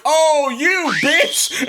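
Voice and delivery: slow, high-pitched indignant cry; mock outrage. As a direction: draw it out high, playfully outraged. Rival reacts, acted out.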